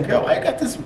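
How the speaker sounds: high, querying mimicked woman; voicing the woman. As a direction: pitch it up as her nosy question. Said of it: "She say".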